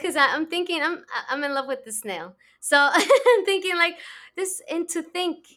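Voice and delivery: high, loud laughing delivery; giddy with emotion, laughing; laughing excitedly. Real, not acted. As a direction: gush through laughter, overwhelmed. Host laughing, overcome with emotion.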